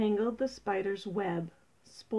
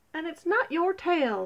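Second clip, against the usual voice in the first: higher-pitched, huffy snake; sniffy retort; 8 semitones higher, 3 dB louder than usual. Acted. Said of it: "sniffed the snake".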